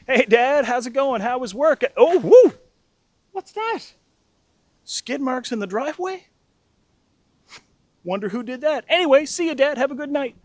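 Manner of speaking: high, breezy fake innocence; imitating a scheming brother's sing-out greeting; tattling brother. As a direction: feign innocent surprise to land someone in trouble. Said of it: "my brother, to get revenge".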